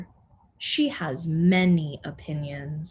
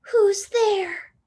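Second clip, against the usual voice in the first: high, louder startled question; startled call; 12 semitones higher, 6 dB louder than usual. Acted. Baby woken in bed, calling out alarmed. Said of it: "she said".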